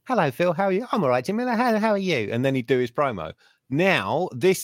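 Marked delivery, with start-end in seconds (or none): 0.0-2.3 higher, sweet mock-polite exchange; polite pleasantries. Phil and Jamila greeting each other, higher mock-polite. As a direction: act out a nice boy being overly courteous. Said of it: "a nice boy exchanging pleasantries".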